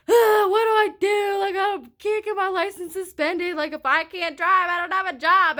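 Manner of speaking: high, loud panicked wail; panicked outburst. Retold or imitated. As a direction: replay the panic at full volume. Retold panic over license suspension.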